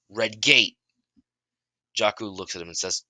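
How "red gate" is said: loud alarmed cry. Acted. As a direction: shout the warning to everyone. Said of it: "and says out loud".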